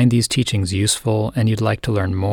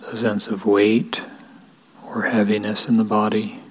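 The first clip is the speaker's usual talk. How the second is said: guided meditation. Real, not acted. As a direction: lead them gently into stillness, unhurried, leaving space between phrases. Leads a guided equanimity meditation.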